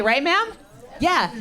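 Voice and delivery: ordinary talk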